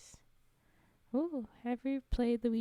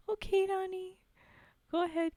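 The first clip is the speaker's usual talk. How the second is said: bright send-off; 9 semitones higher, 5 dB louder than usual. Real, not acted. Cheerful send-off to viewer.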